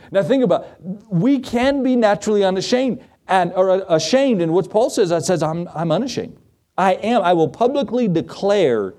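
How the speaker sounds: high, ringing sermon delivery; preaching. Real, not acted. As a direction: proclaim it boldly to the congregation. Preacher raising his pitch to proclaim.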